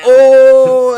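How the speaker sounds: loud, high drawn-out "ohhh" of a jeering crowd; whole crew reacting with a big "ohhh"; crowd's "oh". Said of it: "everybody's like".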